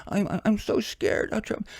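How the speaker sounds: high, slow whiny frightened voice; mock scared people. Vlogger mocking frightened voters, high and whiny. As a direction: mimic a panicky voter, high and drawn out. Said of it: "People are all scared".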